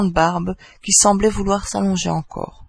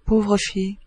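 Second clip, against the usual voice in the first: a touch of put-on sorrow; mock-sad sigh. A narrator's reading. Joker pitying the absent woman, with mock sadness. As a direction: sigh it with feigned pity. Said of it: "il prenait un air triste pour soupirer".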